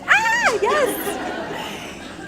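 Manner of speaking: delighted recognition with a laugh, leaping very high; ah yes, breaking into a laugh; excited. Real, not acted. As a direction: squeal of nostalgic delight. Recalling the club Spit.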